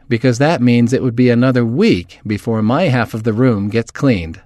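usual speaking voice

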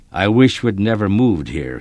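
ordinary delivery

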